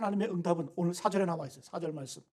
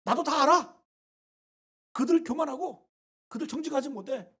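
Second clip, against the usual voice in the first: a hint of God's voice, higher and louder at the start; God speaking; 4 semitones higher, 3 dB louder than usual. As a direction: lightly voice God brushing the charge aside. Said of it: "하나님께서".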